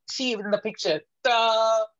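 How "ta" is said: Ta with a clean tongue release; crisp ta. Shown as a demonstration. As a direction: tap the tongue and let go on Ta. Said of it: "this is where touch your tongue".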